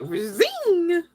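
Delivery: high, loud, drawn-out "zing"; sudden bright burst of sound effect; imitated energy zing. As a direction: let the zing shoot up and ring out. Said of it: "the Devil card energy was like".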